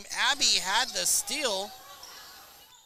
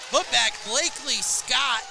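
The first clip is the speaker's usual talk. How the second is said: louder, higher play-by-play shout; excited call; 6 semitones higher, 7 dB louder than usual. Real, not acted. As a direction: call the putback with a burst.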